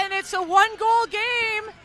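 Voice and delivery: shouted, pitch way up; excited. Real, not acted. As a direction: call it over the crowd. Goal call climax.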